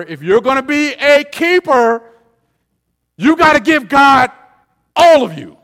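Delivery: loud, high, drawn-out exhortation; full-force sermon climax; emphatic preaching. Real, not acted. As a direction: drive each phrase home loudly. Preacher drives home the demand.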